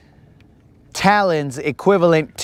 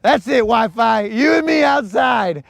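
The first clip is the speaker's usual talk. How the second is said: higher, hammed-up call-out to fight; mock tough-guy challenge; 7 semitones higher than usual. Retelling Colin picking a fight with Wi-Fi, hammed-up challenge. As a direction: playfully dare the Wi-Fi to step outside. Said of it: "He's like".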